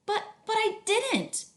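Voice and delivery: high, slow, halting but; high stuttering child's protest; stammering protest. Acted. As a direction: plead in a small high voice.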